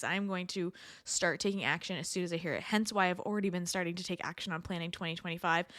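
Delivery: ordinary delivery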